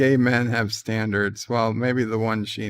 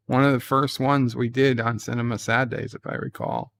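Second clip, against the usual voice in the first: usual speaking voice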